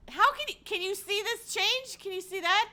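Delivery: high, loud disbelieving questions; incredulous. Real, not acted. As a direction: pitch up in frantic disbelief. Asking chat if visible.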